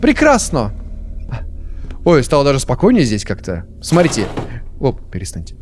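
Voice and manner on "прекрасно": a light touch of Italian chef; jolly Italian pizza chef. Pretend. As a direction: toss it off like a happy chef. Celebrates in mock Italian. (весёлый итальянский повар)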